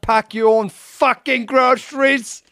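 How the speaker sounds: high, loud, slow mock heckle; hammed-up heckle at a quarterback; angry British fan. Fan heckling Aaron Rodgers, loud slow mock heckle.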